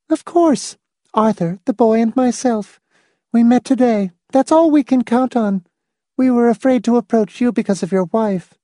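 high, even woman character voice; Miss Rice's voice. Acted. Miss Rice explaining the plan to Hamilton, high character voice. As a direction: voice her far above the narrator's pitch.

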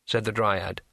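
ordinary delivery, nothing marked

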